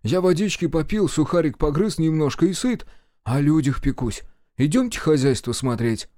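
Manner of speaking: high, ingratiating character voice; eager supply officer. A narrator's reading. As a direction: plead your selflessness in a high pitch. Officer plays selfless provider. (угодливый интендант, высоким голосом)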